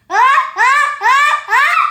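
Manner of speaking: high loud gasping ah-ah before a sneeze; sneeze build-up. Acted. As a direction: wind up a big sneeze.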